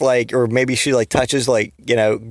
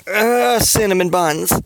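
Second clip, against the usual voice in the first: higher groan sinking into the words; defeated; 6 semitones higher, slower than usual. Shown as a demonstration. Showing how Flash would say it, mock defeated. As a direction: groan it out like a beaten man. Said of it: "in a defeated kinda way".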